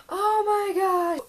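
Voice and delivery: gasp, then a slow tearful-sounding oh my gosh; high breathy awe, drawn out; awed gasp. Real, not acted. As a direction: overcome by how pretty it is. Overwhelmed by beautiful book.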